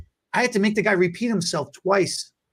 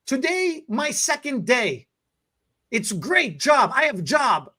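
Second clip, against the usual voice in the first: high, slow broken English in a mock foreign accent; proud broken English, high and slow; imitating the cashier's foreign accent, proud; 7 semitones higher, slower than usual. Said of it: "thickest accent".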